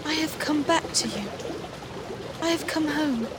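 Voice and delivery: put-on a girl begging her brother to take her back; Maggie, pleading. A narrator's reading. Maggie voiced high, pleading.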